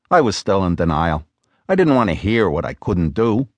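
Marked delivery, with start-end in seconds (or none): none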